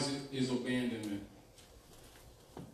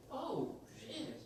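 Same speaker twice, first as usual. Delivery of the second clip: put-on high, hushed dismayed girl; Dorothy voice; 10 semitones higher, 11 dB quieter than usual. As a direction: gasp it out softly, caught off guard.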